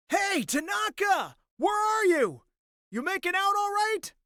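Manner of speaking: high, loud shout into the distance; calling out. Acted. Friend searching the woods for Tanaka, calling out. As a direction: holler for a missing friend. Friend calls through the forest.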